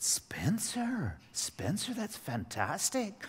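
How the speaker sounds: breathless, hushed delight; excited whisper. Retold or imitated. A father whispering excitedly to his son about Egypt. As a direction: whisper the praise, slowing on fantastic.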